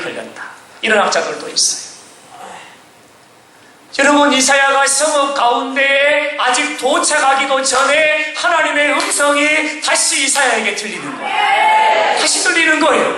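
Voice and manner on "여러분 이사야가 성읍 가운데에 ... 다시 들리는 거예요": high, sustained pulpit cry; full-force preaching. Real, not acted. Preacher proclaiming God's voice returning to Isaiah, impassioned shouting. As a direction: build the news to a stretched-out shout.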